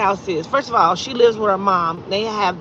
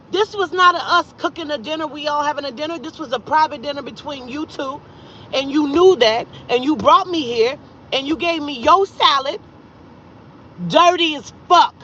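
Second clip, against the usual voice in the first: higher-pitched retold scolding; indignant accusation; 5 semitones higher than usual. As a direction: lay out the betrayal point by point, voice raised in pitch. Retold quarrel over the dinner.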